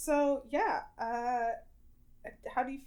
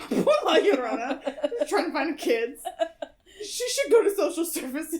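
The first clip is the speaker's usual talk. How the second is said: laughing; 5 semitones higher, 6 dB louder than usual. Real, not acted. Laughing while joking.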